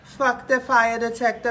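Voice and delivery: ordinary talk, nothing marked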